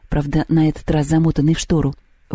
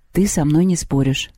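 plain reading voice

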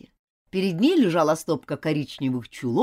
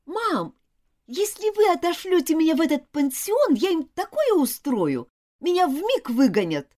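put-on high defiant child's threat; threaten, stubborn and bold; threatening girl; 11 semitones higher, 4 dB louder than usual. A narrator's reading. Girl threatens her mother about boarding school, defiant. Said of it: "пригрозила Элизабет".

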